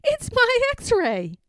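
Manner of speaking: put-on high, slow little-girl voice, amused; a small girl pointing out her own x-ray with delight; giggly little girl. Girl with a cast points at the tree, high and tickled. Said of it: "she giggled".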